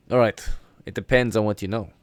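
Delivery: booming captain's challenge; loud demand. Acted. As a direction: call it out loud and firm to the prisoners. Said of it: "He says, uh".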